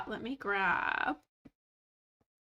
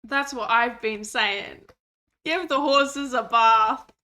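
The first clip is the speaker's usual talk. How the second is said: loud and slow, mock grand; loud proclamation; 10 dB louder, slower than usual. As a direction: bellow it like a decree.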